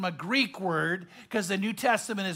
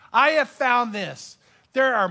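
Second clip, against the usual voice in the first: usual speaking voice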